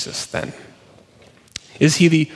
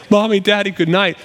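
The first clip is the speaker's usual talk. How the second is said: higher, milder imitation of a child's call; re-enacted bedtime call, softened from a wail; 6 semitones higher than usual. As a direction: lightly mimic a little girl calling to her parents. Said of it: "she's calling out and saying".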